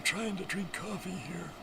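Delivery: very high squeaky put-on voice; squeaky mock voice. Joking about drinking coffee in a very high squeaky put-on voice. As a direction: squeak it out like a cartoon critter.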